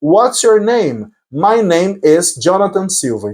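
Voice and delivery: slow, careful question and answer; slow model dialogue. Shown as a demonstration. Teacher rehearsing an interview exchange, slowly.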